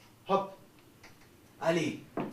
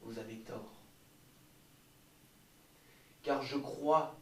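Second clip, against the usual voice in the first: normal talking voice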